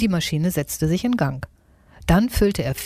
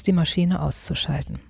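plain reading voice

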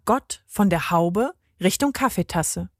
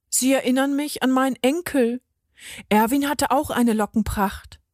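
higher, gently voiced lady; lightly wistful character, pitched up; old lady, wistful; 5 semitones higher than usual. Lady remembers her grandson's curls, mildly wistful, higher voice.

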